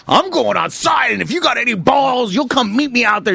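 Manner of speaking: high shouted challenge to step outside; mock scream. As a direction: yell the invite to fight, high. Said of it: "he screams at me".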